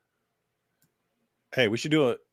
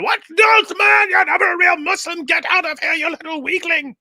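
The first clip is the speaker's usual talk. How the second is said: very high, loud hammed-up rant; squeaky cartoon shouting of a preacher; mock preacher shout; 4 dB louder than usual. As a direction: parody an outraged preacher yelling. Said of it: "Muhammad Hijab responding".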